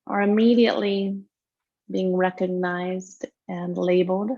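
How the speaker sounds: guided meditation. Real, not acted. Yoga teacher settling students into tonight's practice, guided meditation. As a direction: lead them gently into breath awareness, unhurried.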